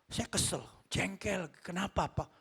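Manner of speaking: ordinary delivery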